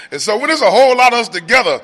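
ordinary delivery

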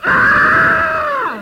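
loud high crying wail; imitating a full-on crying scream; retold wail. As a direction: let out a huge sobbing wail. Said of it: "I'm talking about".

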